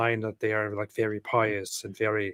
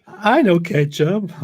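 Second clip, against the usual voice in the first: silly slow drawl; 7 semitones higher, 7 dB louder, much slower than usual. Pretend.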